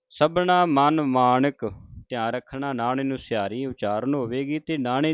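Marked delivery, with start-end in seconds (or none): 0.1-1.7 slow recitation. Acted. Reciting Gurbani.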